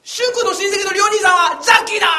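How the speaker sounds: loud high cheering; excited kids shouting. Retold or imitated.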